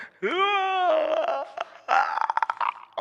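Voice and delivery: megaphone yawn. Pretend. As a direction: belt out a yawn through the megaphone. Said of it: "let's yawn with a megaphone".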